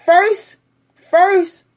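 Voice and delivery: high, loud "First" like a teacher; emphatic lecturing. Real, not acted. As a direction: start the history lesson with force. Lecturing commenter on history.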